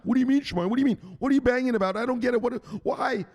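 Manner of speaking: high squeaky questioning voice; mock confused viewer. Host mocking a viewer, high whiny confusion.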